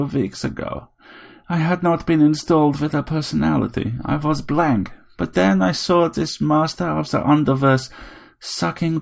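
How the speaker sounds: a tiny robot playing a grand vampire; robot Dracula. A narrator's reading. Vampire robot telling Harry it wants all his blood.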